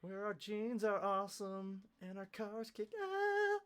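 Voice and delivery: high, slow, drawn-out bragging; hammed-up all-American bragging; mock patriotic boast.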